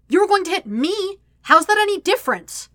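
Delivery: high, loud, outraged questioning; indignant challenge. A narrator's reading. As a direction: throw it back in angry disbelief. Indignant retort in the quarrel.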